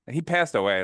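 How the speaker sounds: normal talking voice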